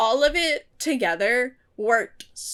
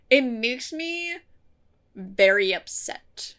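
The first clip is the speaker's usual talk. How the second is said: ordinary delivery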